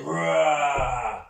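playful growling dinosaur roars; T-Rex roar. Pretend. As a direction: roar along with the class as a T-Rex. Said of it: "making the noise of the T-Rex".

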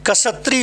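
"कसत्री" opened with k plus s; corrected kshatri. Shown as a demonstration. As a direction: say "कसत्री" as ka-sa-tri. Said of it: "छा को कसा बोला जाता है".

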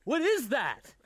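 high loud outburst; startled yell at the taste; shocked. Real, not acted. Reacting to a terrible berry.

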